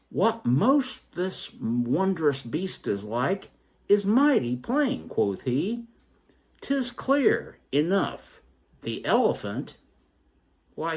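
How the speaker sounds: high, slow, marvelling voice; voiced blind man. Fourth blind man quoted.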